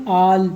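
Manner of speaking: ऑल with an open o for the a; double-l vowel rule. Shown as a demonstration. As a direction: say ऑल with a rounded aw sound before l. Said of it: "तो इसको क्या पढ़ेंगे".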